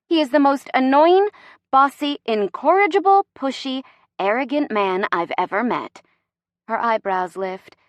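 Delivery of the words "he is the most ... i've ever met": high, emphatic list of faults; indignant venting; exasperated rant. Acted. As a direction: punch each adjective in irritation. Rants about boyfriend's faults.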